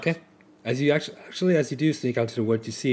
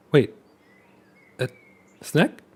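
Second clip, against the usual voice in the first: curious hopeful call; 5 semitones higher, 3 dB louder than usual. Acted.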